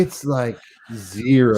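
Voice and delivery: high, slow, stretched-out words; drawn-out demo read. Shown as a demonstration. Host showing co-host how to say it, drawn out and high. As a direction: draw each word out up high, with ZERO held long. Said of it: "now say it like this".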